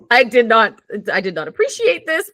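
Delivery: mock indignant teenager. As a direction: imitate your bold younger self telling off teacher. Imitating younger self confronting a teacher.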